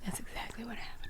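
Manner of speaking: soft breathy whisper. Acted. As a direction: whisper it for dramatic effect. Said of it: "Why are you whispering?".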